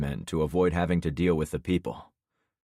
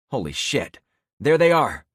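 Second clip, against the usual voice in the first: much higher and louder, sudden alarm; alarmed shout; 7 semitones higher, 7 dB louder than usual. A narrator's reading. A passenger spots the pursuing jeep and shouts in alarm. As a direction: yell it out as you spot them.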